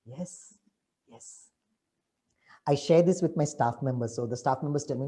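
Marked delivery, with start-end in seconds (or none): none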